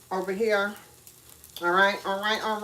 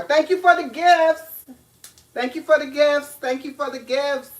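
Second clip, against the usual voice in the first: excited thanks; 6 semitones higher, 6 dB louder than usual. Real, not acted. Thanking viewers for gifts.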